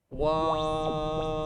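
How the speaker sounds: filtered voice sweep; pitch sweep effect. Shown as a demonstration. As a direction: bend the voice with the effect. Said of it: "you can even go".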